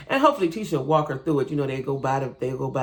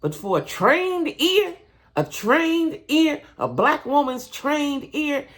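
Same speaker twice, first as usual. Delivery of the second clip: emphatic; 10 semitones higher, much slower than usual. Real, not acted. Emphatic build on trained ear.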